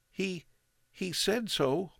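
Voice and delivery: put-on high, boyish protest; boy questions the father, upset; young boy. A narrator's reading. Boy stammering.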